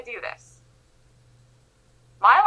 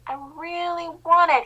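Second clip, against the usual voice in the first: elephant pleading; 6 semitones higher, slower than usual. Acted. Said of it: "he says".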